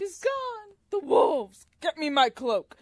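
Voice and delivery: high, loud melodramatic cries; mock alarm. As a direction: play the panicked villagers, over the top. Acting out characters' dramatic panic.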